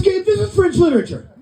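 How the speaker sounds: cracking up while delivering the teacher's line; punchline broken up by laughter; laughing through it. Real, not acted.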